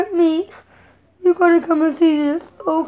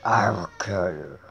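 very low, much softer, growling monster threat; mad monster voice; 8 dB quieter than usual. Pretend. Child showing off his mad voice to Mama, deep monster growl. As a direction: drop into a deep, rumbling monster voice to scare Mama. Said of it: "You see my mad voice".

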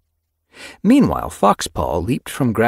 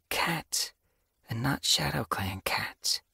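hushed, much softer, slowing; softly mewed; 8 semitones higher, 9 dB quieter, slower than usual. A narrator's reading. Apprentice warns of strange cats nearby, softly. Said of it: "she mewed softly".

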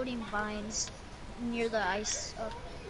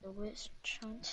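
whispering; 3 semitones lower, 9 dB quieter than usual. Real, not acted. Muttering location to himself.

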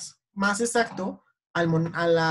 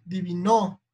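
diviNO, wrongly stressed on NO; stress on last syllable; slower than usual. Shown as a demonstration. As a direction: throw the weight onto the final NO of "divino". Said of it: "Ya sabemos que es". (divino con el acento cambiado a NO)

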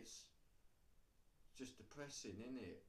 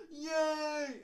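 high, loud, drawn-out whoop; mock cheer; 14 dB louder than usual. People looking forward to Christmas, mock-excited cheer. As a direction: cheer like an overexcited kid. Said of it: "So they go looking forward to it".

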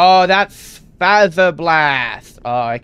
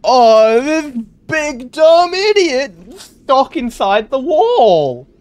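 gleeful mockery; 9 semitones higher, 4 dB louder, slower than usual. Real, not acted. Mocking stuck Roxy.

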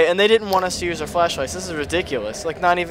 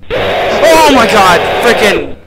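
real jump-scare yell, high and very loud; startled shout; 6 semitones higher, 13 dB louder, slower than usual. Real, not acted. Player hit by a jump scare, startled shout. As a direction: shriek it out, drawn long and slow.

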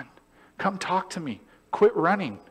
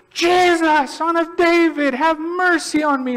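much higher, louder plea; crying out; 8 dB louder, slower than usual. Retold or imitated. Blind man calling to Jesus, loud and pleading. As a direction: shout for mercy over the crowd. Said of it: "crying out".

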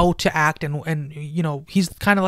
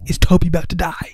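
anxious whisper; faster than usual. Retold or imitated. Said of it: "You're just like".

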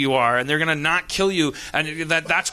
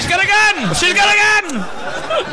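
high, loud pretend panic; mock alarm shout; 6 dB louder than usual. Comic interrupting the story, high mock-alarm shout. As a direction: yell a hammed-up warning.

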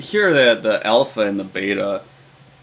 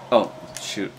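ordinary talk, nothing marked

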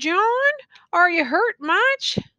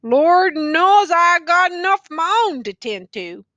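a hint of despair in a louder, strained voice; touch of despair; 6 semitones higher, 5 dB louder than usual. A narrator's reading. Tattered man answers the youth with a touch of despair. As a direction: let a little despair leak through. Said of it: "accent of despair in his voice".

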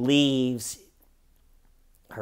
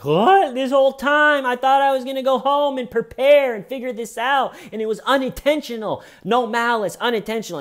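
much higher, loud stunned protest; shocked disbelief; 14 semitones higher, 8 dB louder than usual. Retold or imitated. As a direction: blurt it out in high-pitched disbelief. Suspect learns she is arrested.

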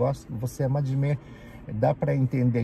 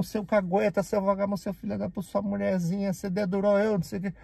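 retold furious insults; 8 semitones higher than usual. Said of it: "mas fungando".

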